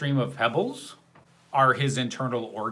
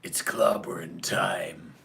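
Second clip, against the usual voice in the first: low, slow gravelly growl; the Thing's growl; 7 semitones lower, 5 dB quieter, slower than usual. Shown as a demonstration. Streamer showing chat how to impersonate the Thing, low growl. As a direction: growl it out deep and drawn-out. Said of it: "get the growl in your voice and go".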